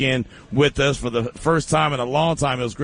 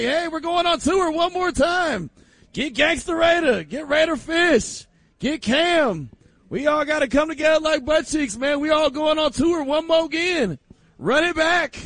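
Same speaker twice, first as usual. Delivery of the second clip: much higher, hyped-up call to get the band back; hammed-up band reunion hype; 12 semitones higher than usual. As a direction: pitch it way up like a showbiz reunion tour pitch. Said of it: "Remember, they're like".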